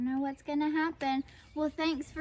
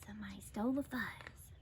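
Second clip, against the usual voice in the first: quick, secretive whisper; hushed whisper; 4 semitones lower, 11 dB quieter, faster than usual. A narrator's reading. Prairie dogs pass on theft news, whispering. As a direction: whisper it like a shocking rumour.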